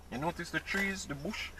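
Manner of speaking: talking around ice cubes; words muffled by ice in mouth. Real, not acted. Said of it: "Sorry, my ice in my mouth".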